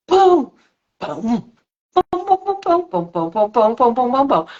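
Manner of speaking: PÃO leaping high, dipping, then a rapid run of PÃOs; exaggerated intonation game. Speech therapy circle chatting in one word, exaggerated intonation. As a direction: chatter only in "pão", swooping up and down. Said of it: "coisas com entonações só usando a palavra pão".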